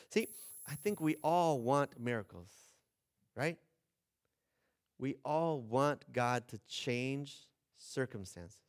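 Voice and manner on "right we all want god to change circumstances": normal talking voice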